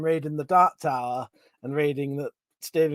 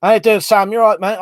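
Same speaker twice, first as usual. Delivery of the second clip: loud greeting; 5 semitones higher, 11 dB louder than usual. Real, not acted. Greeting viewer on stream.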